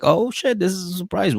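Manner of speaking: high, hammed-up gasp of surprise; fake surprise. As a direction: overplay the shock, pitch way up. Family faking surprise.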